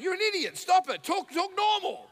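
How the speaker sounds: high, loud exasperated telling-off; self-scolding. Retold or imitated. Passenger ordering himself to behave, high exasperation.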